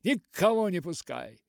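loud, high call to a mate; rousing battle shout. A narrator's reading. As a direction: cheer a friend on in a fight, loudly. Battle cry to his companion. (задорный боевой выкрик)

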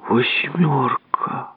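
drawn-out, very slow horrified whisper; slow whisper of dismay. Acted. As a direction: breathe it out softly, aghast at the damage. Dismayed at the wrecked wheel.

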